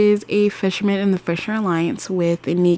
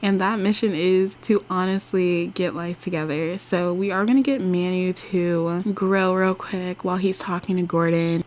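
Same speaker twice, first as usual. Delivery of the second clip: normal talking voice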